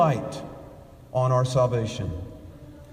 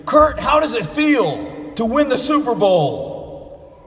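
higher, louder, eager reporter's question; excited reporter; 6 semitones higher, 3 dB louder than usual. Retold or imitated. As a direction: rush the question like a thrilled reporter. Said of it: "asked him excitedly".

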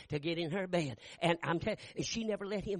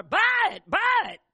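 high, loud pleading push; urgent wife; 11 semitones higher, 9 dB louder, slower than usual. Retold or imitated. Wife urging husband to buy the car, high shouting. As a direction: shout it up high to sell him.

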